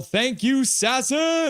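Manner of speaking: high, exclaimed gratitude; excited fan thanks. Acted. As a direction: cheer the thanks out, pitch jumping up. Reading out a fan's thanks.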